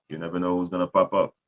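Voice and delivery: ordinary talk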